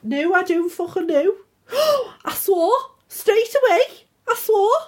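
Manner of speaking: high, put-on Scouse accent; mock-shocked in Scouse, pitched high; attempted Scouse. Friend caught swearing, high mock Scouse outrage. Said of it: "That's a good accent".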